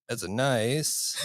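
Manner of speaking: high, drawn-out mock-foreign accent, Borat style. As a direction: stretch it slowly in a Borat-like accent.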